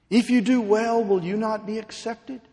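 high, loud pleading sermon; impassioned preaching. Acted. Preacher pleading with Cain, at full force.

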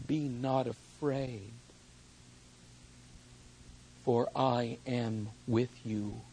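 very soft, low, slow promises; tender hush. Acted. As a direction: whisper the promise like a comfort at a bedside. Soft quoted promises of God.

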